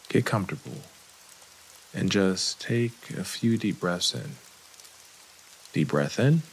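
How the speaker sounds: calm, unhurried meditation guide, long pauses; guided meditation. Acted. Therapist guiding listeners through breathing and a body scan, calm meditation voice. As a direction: ease the listener into relaxing, leaving room to breathe.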